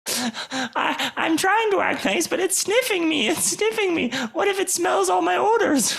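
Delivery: shrieky, very high frantic voice; terrified critter babbling at top pitch; panicked creature. Acted. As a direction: protest in a squealing panic. Creature panics at sniffing tentacle.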